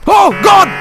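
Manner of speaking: loud, high-pitched scream; real gamer despair; anguished shout. Real, not acted. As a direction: howl in dismay at the game. Attack fails.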